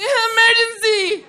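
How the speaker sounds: very high, drawn-out squeal; little skinny kid's voice. Retold or imitated. Little kid calling out an emergency, high drawn-out imitation. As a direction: imitate a small child's shriek, stretched slow and loud. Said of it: "his voice was like that".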